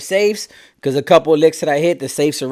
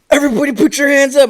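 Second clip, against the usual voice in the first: higher, louder imitated holdup yell; retold shout; 9 semitones higher, 6 dB louder, faster than usual. Co-defendant bursting in and shouting a holdup order. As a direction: bark the order like storming in.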